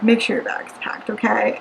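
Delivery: put-on hushed whisper to a child; softly whispered reminder to daughter. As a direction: whisper it so no one hears. Mother hushing before school.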